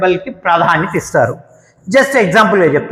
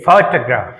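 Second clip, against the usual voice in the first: Photograph with a strong ఫో, weak remaining syllables; stress on first syllable; 6 semitones lower than usual. Shown as a demonstration. Teacher modelling for students, first syllable of photograph stressed. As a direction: punch the opening ఫో of photograph. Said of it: "ఇక్కడ stress ఉంటుంది".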